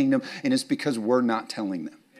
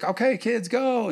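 high, sing-song go call; mock starter call; 11 semitones higher, slower than usual. Pastor imitates the egg hunt start, high and light.

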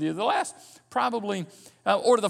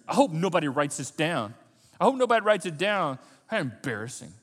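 ordinary talk

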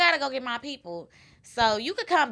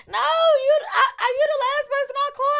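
much higher fake panicked girlfriend voice; panicked friend voice. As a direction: squeal it like a friend stuck with a flat tire. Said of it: "it's gonna be like".

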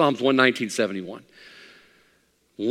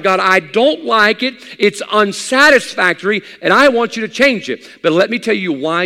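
high, loud pulpit delivery; full-force preaching; 9 semitones higher, 8 dB louder than usual. Acted. Preacher warning believers about the urge to complain, full-force preaching. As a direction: drive each point home to the congregation.

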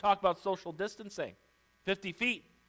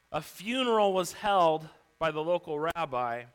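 ordinary talk

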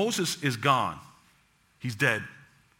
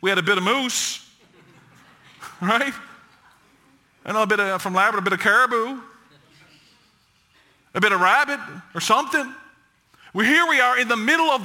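mock whiny grumbling, higher and fast; complaining Israelites; 6 semitones higher than usual. Preacher mimics Israelites griping about missing meat, higher and quicker. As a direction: play the people griping about food. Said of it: "they said to Moses".

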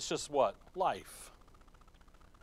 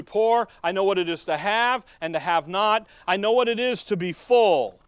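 louder preaching cry; preaching at full force; 2 semitones higher, 8 dB louder than usual. Real, not acted. Preacher rousing the congregation, loud preaching. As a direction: drive it home to the church.

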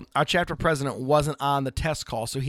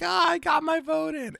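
excited voter. Retold or imitated. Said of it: "And they're like".

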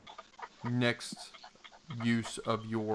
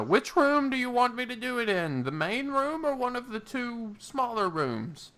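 Danforth voice; 12 semitones higher than usual. Acted. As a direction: pitch way up for the old mage.

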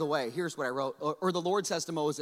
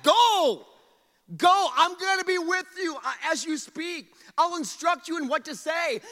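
loud, high, forceful command; God getting mad; 9 semitones higher, 8 dB louder than usual. Acted. God orders reluctant Moses to go, angry and commanding. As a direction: thunder the order at full force. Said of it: "You can almost hear God getting mad".

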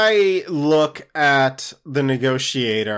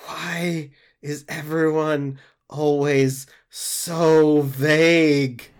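weary sigh; much slower than usual. Acted. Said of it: "sighs and says".

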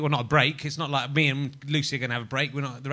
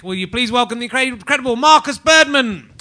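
host announcement; 9 semitones higher, 11 dB louder than usual. Real, not acted. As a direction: sell the guest to the crowd, rising to a big finish. Introducing the guest comic.

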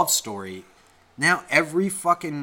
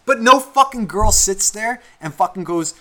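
normal talking voice

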